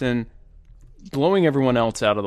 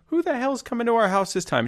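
much higher mock-annoyed husband; 11 semitones higher than usual. Husband retelling ad, mock-grumpy much higher voice. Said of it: "he goes".

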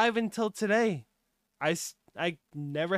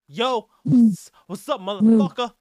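loud hype greeting; 3 semitones higher, 11 dB louder than usual. Acted.

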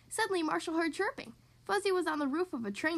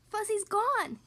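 worried; 7 semitones higher, slower than usual. A narrator's reading. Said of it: "he said".

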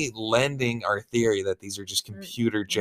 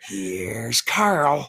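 Jack Nicholson impression; 5 semitones higher than usual. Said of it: "The Shining".

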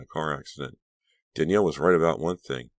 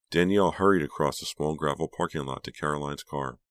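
plain reading voice

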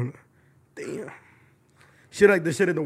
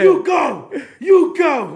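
high-pitched mock cheerleading; imitated cheering; 10 semitones higher, 5 dB louder, much slower than usual. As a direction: cheer her on in a raised, high voice. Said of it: "She was like".

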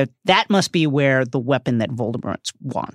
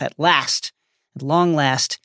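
ordinary talk

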